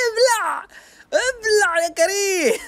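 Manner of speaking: slow, high, drawn-out taunt; gloating taunt. Real, not acted. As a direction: rub it in slowly. Gloating at enemy.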